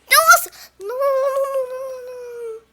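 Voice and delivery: high frantic no no no; panicked. Real, not acted. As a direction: panic rising. Panicking in horror game.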